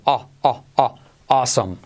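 "A, a, a" open aw, then "awesome"; vocal A repetida. Shown as a demonstration. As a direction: repeat the vowel "A" before "awesome". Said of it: "con esa vocal A". ("A" abierta repetida antes de "awesome")

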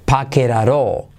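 "Paqueraró" with each T tapped like Spanish R; linked soft R. Shown as a demonstration. Teacher repeating the rule, "Paqueraró" with soft tapped Rs. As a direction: join it into one word, "Paqueraró". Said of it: "se convierte en R suave española".

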